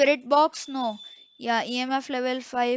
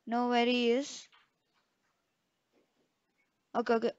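ordinary talk

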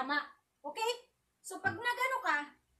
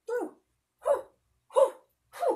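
sharp exhaled hu with each strike; kiai grunts; 5 semitones higher, 7 dB louder than usual. Shown as a demonstration. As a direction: punch out a breathy hu on every count. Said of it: "Step one". (matinding hu sa bawat suntok)

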